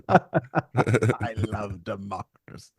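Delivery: very slow villain impression through laughter; slow, hammy villain with a laugh underneath; Palpatine. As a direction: draw out each word like a scheming politician. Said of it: "like Palpatine in".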